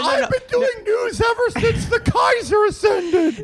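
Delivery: put-on high, slow shouted old-man boast; hammed-up old anchor yell; mock old anchor shout. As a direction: bellow like a doddering old newsman.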